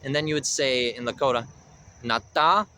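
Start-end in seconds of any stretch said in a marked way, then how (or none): 2.0-2.7 nata with a light nasal N, second syllable stressed. Shown as a demonstration. Teacher giving the Lakota word for head, nasal N. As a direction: say nata slowly and clearly, nasal on the N. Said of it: "do a little bit of a nasal N".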